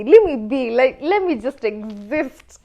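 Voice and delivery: single woman tired of being asked to date, loud exasperation; exasperated outburst. Real, not acted. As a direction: blurt it out, done with everyone. Fed up with dating questions.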